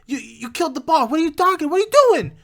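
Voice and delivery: high, frantic stammer rising to a yell; hammed-up alarmed goblin; shocked goblins. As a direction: build to a loud, high-pitched panicked demand. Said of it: "they're just like".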